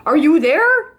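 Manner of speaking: a hopeful call across the field. A narrator's reading. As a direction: call out searching.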